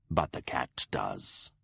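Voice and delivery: molto più bassa, lenta e sommessa; voce del lupo. Acted. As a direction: osservazione pacata del lupo. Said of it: "Night Eyes pointed out to me".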